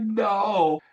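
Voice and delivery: high, loud, emphatic no; exasperated high-pitched reply; retold indignant denial. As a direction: protest it in mock outrage. Retold indignant answer to teammate.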